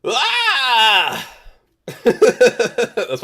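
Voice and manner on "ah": sudden high shrieking yell; swearing impression. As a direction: let out one short high yell. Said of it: "can I do my swearing impression?".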